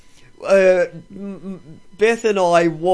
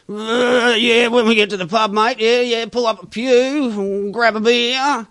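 higher, put-on ocker truckie voice; truck driver; 5 semitones higher than usual. Host mocking truck drivers, higher put-on ocker voice. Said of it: "you've got the truck drivers".

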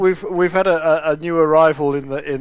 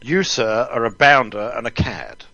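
put-on gentlemanly English accent, lower pitch; 7 semitones lower than usual. Co-host showing the host what to say, English accent. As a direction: deliver a mock rebuke in a proper English accent. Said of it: "saying in an English accent".

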